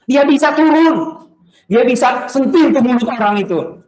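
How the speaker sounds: high, loud declaration; forceful preaching. Real, not acted. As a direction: proclaim it at full force, pitch soaring. Preacher's emphatic climax on power.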